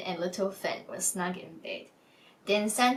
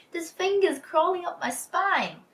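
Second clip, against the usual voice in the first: much higher, whiny squeal; whining thief; 10 semitones higher, 4 dB louder than usual. Acted. Lynn whining as the mouse creeps inside her top, high and whiny. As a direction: whine like a frightened crook. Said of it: "lanky Lynn began to whine".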